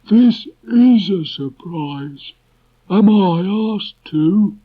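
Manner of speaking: very high, loud, gloomy donkey drawl; a put-upon old donkey greeting; Eeyore voice. Acted. Eeyore greets the visitors walking up, high and loud.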